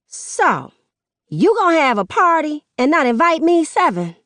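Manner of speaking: an angry mother calling out her son in public; indignant mother. Acted. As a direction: confront him, stung and loud. Mother confronting son at party.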